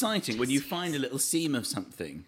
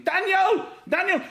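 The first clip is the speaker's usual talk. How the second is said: put-on high, loud call of the child's name; frantic shout; 8 dB louder than usual. Father running onto the pitch to spray his child, shouting. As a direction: bellow for your son across a pitch.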